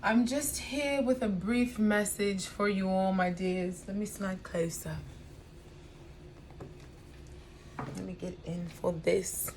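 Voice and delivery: soft, sultry come-closer voice; femme fatale. Acted. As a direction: lean in and purr it softly. Sultry close-up greeting to viewers.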